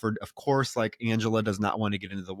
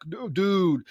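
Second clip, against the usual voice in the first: higher, aggressive put-on bully voice; aggressive complaint; 6 semitones higher, 3 dB louder than usual. Glazer rejecting the rubbers, aggressive put-on voice. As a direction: complain like a pushy teen jerk. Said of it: "it's so aggressive".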